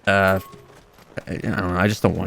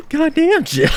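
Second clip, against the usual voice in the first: much higher "Goddamn," cracking up; laughing squeal. Real, not acted. Gamer amazed at Jill, squeaking through laughter.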